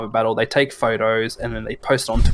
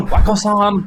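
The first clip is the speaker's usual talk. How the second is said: high, loud cartoonish Japanese accent; mock Japanese accent; 8 semitones higher, 5 dB louder, slower than usual. Co-host imitating gamers calling his friend, mock Japanese accent. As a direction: call out in a hammed-up Japanese accent. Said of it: "That's the wrong accent".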